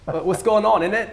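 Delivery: a mock Cockney jab. Shown as a demonstration. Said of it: "the Cockney accent".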